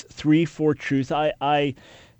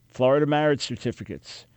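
ordinary talk, nothing marked

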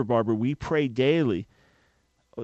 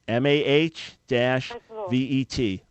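M A H dash V E T spelled slowly; letters spaced out clearly; slow spelling out; slower than usual. Shown as a demonstration. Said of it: "that is how to pronounce it".

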